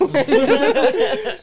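high burst of laughter; big laugh. Real, not acted. Friend laughing hard at his own retold joke, high burst. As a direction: crack up at your own story.

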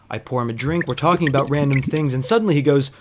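normal talking voice

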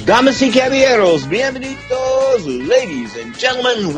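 high, booming ring announcer call; lucha libre announcer. Acted. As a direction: stretch out the welcome like a ring announcer. Show opening, booming ring announcement.

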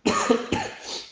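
sharp hacking cough mid-sentence; rough cough from a cold; chesty cough. Real, not acted. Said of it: "mi son preso sta tosse".

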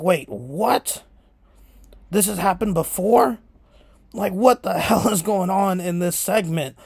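bewildered "what the hell" with a laugh creeping in; retold disbelief. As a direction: act out your stunned, incredulous reaction. Teller acting out own shocked reaction.

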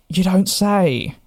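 sarcastic. Retold or imitated. Sarcastic retort to agent.

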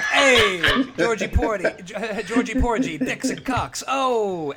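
lightly voiced brash comic, laughing; a hint of a sleazy Brooklyn comic; Andrew Dice Clay. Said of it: "picturing Andrew Dice Clay".

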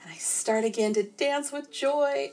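usual speaking voice

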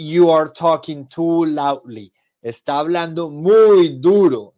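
a mock loud scolding; loud demo. Shown as a demonstration. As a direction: let the volume show it is too loud. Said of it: "vamos a hablar más pasito".

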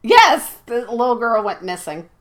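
usual speaking voice

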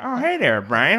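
high voice from the mouth corner; Peter Griffin voice. Pretend. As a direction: talk out of the side of your mouth. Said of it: "you gotta talk out of the side of your mouth".

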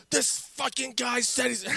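high, slow, breath-starved imitation; too worked up to finish, mimicking; upset hyperventilating. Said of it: "hyperventilating. He's so upset".

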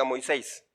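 usual speaking voice

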